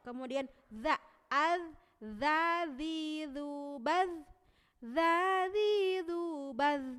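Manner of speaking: "za, zi, zu, baz" loud and drawn out, buzzing on; za recitation. Shown as a demonstration. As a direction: buzz each z sound long. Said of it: "mengalir lembut gitu mengucapkan".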